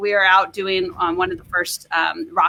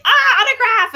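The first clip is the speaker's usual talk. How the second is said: higher, louder squealing fan; mock starstruck fan; 13 semitones higher, 4 dB louder than usual. Fans spotting a celebrity, mock excited squeal. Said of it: "People are like".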